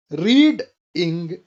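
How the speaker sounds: रीडिंग broken slowly into two parts, रीड and इंग; split into syllables. Shown as a demonstration. Teacher showing two syllables to learners, रीडिंग split slowly in two. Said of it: "यहाँ पर दो syllables हैं".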